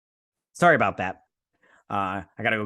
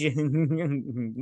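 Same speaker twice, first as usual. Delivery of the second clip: laugh. Real, not acted. Laughs at the burn.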